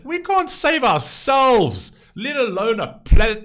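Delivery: high, loud, exasperated cry; exasperated outburst. Real, not acted. Ranting to viewers about saving the planet, exasperated. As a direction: burst out loudly in disbelief, stretching the words.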